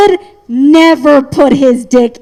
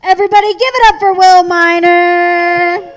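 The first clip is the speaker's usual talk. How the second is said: emcee introduction; 5 semitones higher than usual. Acted. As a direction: hype the crowd for the next act. Emcee introducing next comic.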